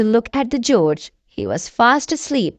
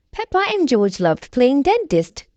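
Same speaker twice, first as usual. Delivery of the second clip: ordinary delivery, nothing marked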